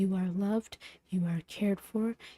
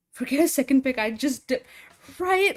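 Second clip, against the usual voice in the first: surprised; 5 semitones higher, 9 dB louder than usual. Real, not acted. Gaming panic, disbelief.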